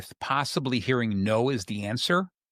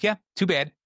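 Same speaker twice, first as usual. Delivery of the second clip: dismissive; 6 semitones higher, 3 dB louder than usual. Retold or imitated. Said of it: "And I'm like".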